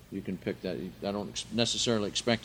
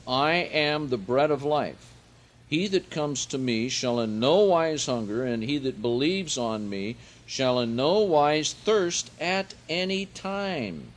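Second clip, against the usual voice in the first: slow, raised preaching voice; solemn scripture reading; 4 semitones higher, 4 dB louder than usual. Acted. Preacher to congregation, slow raised proclamation.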